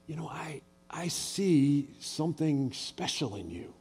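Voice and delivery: hushed slow sincere praise; sincere encouragement. Retold or imitated. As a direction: tell them quietly you believe in them. Encouraging someone into ministry.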